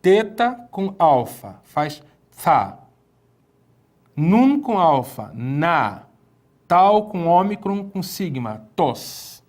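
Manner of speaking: syllable by syllable, slow; teacher spelling Greek letters slowly; slow read-along. Shown as a demonstration. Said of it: "Leia comigo aqui".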